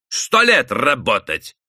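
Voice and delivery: usual speaking voice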